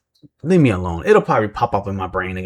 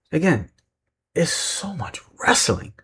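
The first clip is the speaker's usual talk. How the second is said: whispered aside; 6 semitones higher, 5 dB quieter than usual. Acted. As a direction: breathe it like a secret complaint. Aside about too much wrestling.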